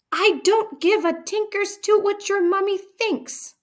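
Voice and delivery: very high, loud contemptuous yell. A narrator's reading. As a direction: roar down at the girl. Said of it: "the Trunchbull yelled".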